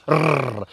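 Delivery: short trilled "Rrr"; rolled R. Shown as a demonstration. Welsh teacher showing learners how to roll R's, short rolled "Rrr". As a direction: roll the tongue on a brief "Rrr". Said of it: "In Welsh, we roll our R's".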